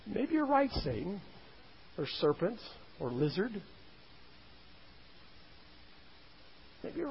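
hushed, tentative wavering, as if being won over; soft musing, giving in to doubt bit by bit; hint of doubt. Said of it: "there's almost a hint of".

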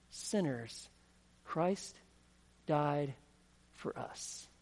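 slow separate words fading to a whisper on "for us"; hushed emphasis. Acted. Preacher landing the key verse for his congregation, hushed and slow.

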